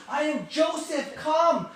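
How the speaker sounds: impassioned acted outburst; shouted revelation. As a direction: cry it out across the room. Joseph cries out to his brothers.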